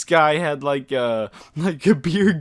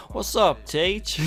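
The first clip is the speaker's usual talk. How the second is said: higher, swaggering street voice to mess with a cop; a higher, cocky slangy put-on voice; 6 semitones higher, slower than usual. Teasing the cop during questioning in a cocky put-on voice. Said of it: "I throw on this voice".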